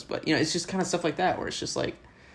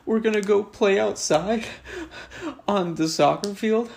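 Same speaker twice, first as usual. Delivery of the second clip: put-on high, louder little girl voice with rising tune; 9 semitones higher, 4 dB louder than usual. Teacher retells pupil dismissing him, high girlish voice. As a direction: chirp it like a bossy little schoolgirl.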